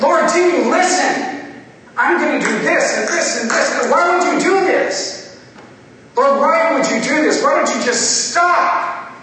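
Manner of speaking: high, loud, drawn-out pleading shout; exasperated outburst. Retold or imitated. Sinner begs the walking Lord to stop, exasperated shout. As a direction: cry out at the top of the voice, fed up. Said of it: "getting frustrated and exasperated".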